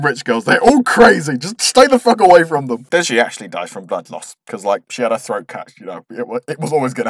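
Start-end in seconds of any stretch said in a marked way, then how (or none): none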